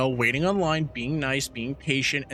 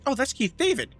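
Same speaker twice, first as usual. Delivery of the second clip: imitated fan recognition; 10 semitones higher than usual. Imitates friend recognising a favourite actor.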